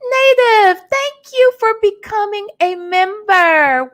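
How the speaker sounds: high, loud burst of thanks to a new member; light up and shout out the new member; excited thanks. Real, not acted.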